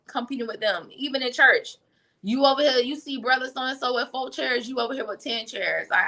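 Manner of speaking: ordinary talk, nothing marked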